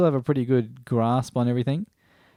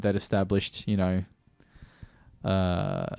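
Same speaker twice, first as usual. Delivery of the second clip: usual speaking voice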